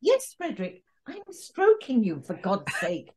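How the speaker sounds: high-pitched, put-upon character voice; hammed-up high voice, falling away at the end; exasperated character. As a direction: answer Frederick in a high, exasperated voice. Voicing a character as a joke.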